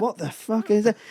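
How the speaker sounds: high-pitched incredulous reaction; baffled disbelief. Retold or imitated. Comic hearing another show behind him, incredulous.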